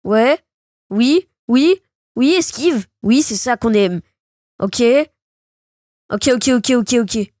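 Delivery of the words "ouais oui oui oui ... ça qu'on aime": normal talking voice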